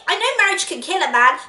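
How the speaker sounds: ordinary talk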